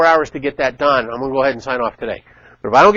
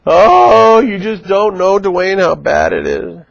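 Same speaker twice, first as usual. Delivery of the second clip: mock whine; 4 semitones higher, 6 dB louder, slower than usual. Mocking a complainer to Dwayne.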